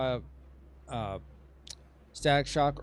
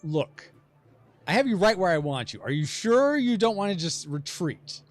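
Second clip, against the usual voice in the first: higher, louder hammed-up bluff; bluffing golem; 7 semitones higher, 7 dB louder than usual. Game master as the losing golem urging retreat, mock confident bluff. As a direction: talk big to make them back down. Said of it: "he's gonna be like".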